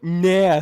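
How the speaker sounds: "nah" with the flat vowel of "cat"; shown vowel sound. Shown as a demonstration. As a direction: stretch "nah" as a sample syllable, like "nyah". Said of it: "nah sound in Japanese".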